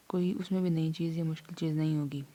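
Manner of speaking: normal talking voice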